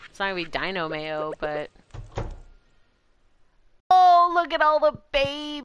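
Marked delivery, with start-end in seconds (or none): 3.9-5.6 high, loud squeal over babies; gushing excitement. Real, not acted. Streamer spotting baby animals in the game, gushing. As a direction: gush loudly, pitched way up.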